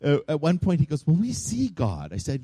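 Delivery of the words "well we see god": put-on high, slow child's question; a hint of child voice, pitched up; retold six-year-old. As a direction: lightly imitate a wondering six-year-old, drawn out. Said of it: "my six-year-old".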